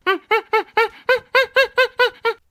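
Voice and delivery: high, loud silly laugh; goofy laugh. Real, not acted. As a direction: cackle like a dimwit. Laugh after the smooth brain.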